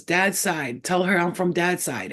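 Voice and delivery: ordinary talk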